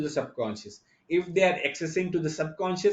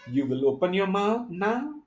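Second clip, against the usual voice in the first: firm falling tune on "You will open your mouth now" like an order; downward tone; 4 semitones higher than usual. Shown as a demonstration. Teacher demonstrating how a falling tone becomes a command. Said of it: "if I take it downward tone".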